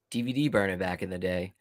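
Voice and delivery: ordinary talk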